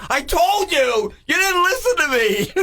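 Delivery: mock big-brother told-you-so; scolding brother. Brother pulling him out of the snowballs: high, slow scolding. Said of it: "He goes".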